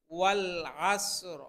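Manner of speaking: intoned, drawn-out tajweed recitation; Quran recitation. Acted. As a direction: recite slowly in a chanting tone. Full surah recited.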